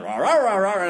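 high, loud "rah rah" barrage; mock scolding gibberish. Grand sponsor phoning to scold him, mock scolding gibberish. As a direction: imitate nagging as wordless noise. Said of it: "My grand sponsor calls".

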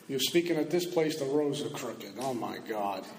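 low, fading scornful voice of the mind, sinking at the end; a hint of a contemptuous inner critic, sinking to a low hush; mocking inner voice. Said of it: "Then the voice tells me".

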